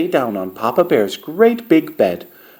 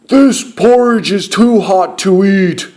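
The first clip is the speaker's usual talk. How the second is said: big loud bear voice, pitched up; roaring Papa Bear; 5 semitones higher, 5 dB louder than usual. A narrator's reading. Papa Bear complains about hot porridge to his family, roaring. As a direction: roar it like a great big bear. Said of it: "roared Papa Bear in his great big voice".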